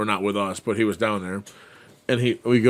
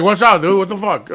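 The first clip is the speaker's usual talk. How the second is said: higher, louder mock yell at the track guy; half-laughing retold yell at the dude prepping the track; 10 semitones higher, 7 dB louder than usual. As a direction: bark it like he's in your way.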